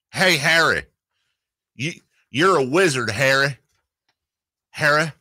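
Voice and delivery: high, badly faked country voice; attempted country accent. Radio host keeps retrying the wizard line, amateur country accent. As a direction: keep fumbling for a country twang. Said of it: "I'm not good at country accents".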